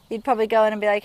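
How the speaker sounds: usual speaking voice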